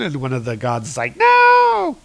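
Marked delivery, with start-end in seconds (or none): 1.2-2.0 high, loud, stretched cry; hammed-up cry of protest, pitched way up; mock yell. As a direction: yell it long like a comic-book god. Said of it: "succeeded in yelling three times".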